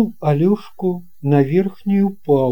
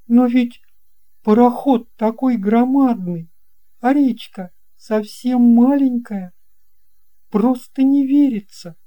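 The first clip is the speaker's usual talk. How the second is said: put-on much higher, louder young boy; an amazed little boy asking the captain; boy's voice; 10 semitones higher, 5 dB louder than usual. (высокий удивлённый мальчишеский голос)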